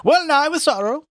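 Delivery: high, loud theatrical lament; mock grave. A narrator's reading. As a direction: declaim it high with hammed-up solemnity. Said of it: "passed out with grave words and gait".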